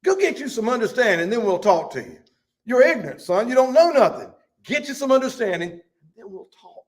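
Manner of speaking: high, loud folksy rebuke; blunt scolding friend. Acted. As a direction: talk down to Job like an ignorant boy. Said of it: "let me put it like this".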